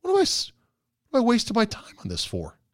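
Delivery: high, fast exasperated self-question; mock frustration at himself. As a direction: snap out of a scrolling daze. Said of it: "I'm turning around and go".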